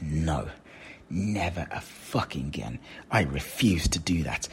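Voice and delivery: weird resolute voice. Retold or imitated. Coach acting out the listener's firm inner refusal in a low voice. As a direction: mutter a firm refusal to yourself. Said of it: "Why is my dad speaking in this weird voice?".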